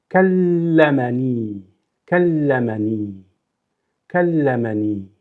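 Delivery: kallamani with a held doubled ll; doubled l stressed. Shown as a demonstration. Teacher showing the shadda to learners, held ll. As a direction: lean on the ll in kallamani, slowly. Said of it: "So there is a stress somewhere".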